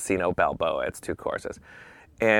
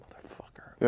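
whispered curse; seething mutter; 6 dB quieter than usual. Retold or imitated. Man bent over his golf bag, seething whisper. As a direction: curse under your breath, seething.

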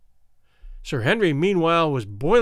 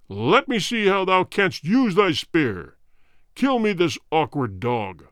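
warrior king. Acted. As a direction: order the kill coldly. King commands the killing.

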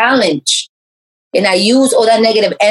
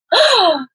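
shocked gasp; 12 semitones higher than usual. Retold or imitated. Retold shocked reaction.

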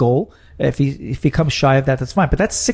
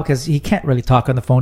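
ordinary talk

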